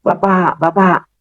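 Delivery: lightly re-enacted calling; calling out for father. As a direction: call "Bapak" upward without raising volume. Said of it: "Saya panggil-panggil".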